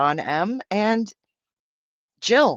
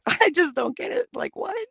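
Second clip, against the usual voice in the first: higher, laughing through the words; 14 semitones higher than usual. Real, not acted. Streamer laughing at a confusing chat question. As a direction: giggle out the whole line. Laughs while repeating herself.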